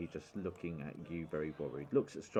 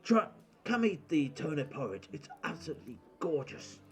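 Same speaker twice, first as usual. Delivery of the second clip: lightly voiced jolly innkeeper guest; hearty Bort; 6 semitones higher, 5 dB louder than usual. Bort invites them to eat.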